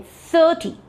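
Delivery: "thirty" with a long, high first syllable; stress on first syllable. Shown as a demonstration. Teacher showing students how thirty differs from thirteen, first syllable stressed. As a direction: draw out THIR in "thirty", short falling "ty". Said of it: "the first syllable".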